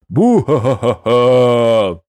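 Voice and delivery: slow booming villain laugh; evil laugh. A narrator's reading. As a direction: cackle like a gloating dark lord. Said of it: "Залился зловещим смехом Бельзидор".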